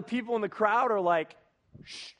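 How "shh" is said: soft, voiceless shush; hushing shh. Acted. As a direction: hush a noisy man in the crowd. Crowd hushing the blind man.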